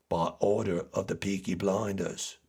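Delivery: put-on Peaky Blinders accent, badly faked. Fan quoting Thomas Shelby, terrible Peaky Blinders accent.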